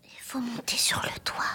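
very soft, low whispered question; hushed, barely voiced murmur; hesitant whisper. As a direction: whisper it, unsure about climbing. Hesitant child told to climb roof.

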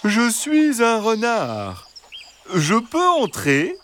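high, slow, sweet fox voice; soft reassuring fox. A narrator's reading. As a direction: coax the hens like a sly gentle fox. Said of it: "Une voix douce et rassurante".

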